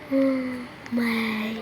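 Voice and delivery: ordinary talk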